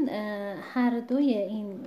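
usual speaking voice

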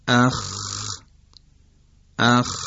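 long rasped kh ending "اخ"; vowel, then a sustained voiceless throat fricative in "اخ"; demonstrating khe. Shown as a demonstration. Teacher showing where khe is articulated, drawn-out demonstration. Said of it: "خ هست،".

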